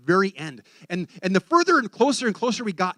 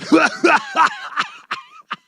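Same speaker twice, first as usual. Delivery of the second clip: loud, high evil cackle; devil's laugh; 8 dB louder than usual. Retold or imitated. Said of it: "That's how the devil laughs".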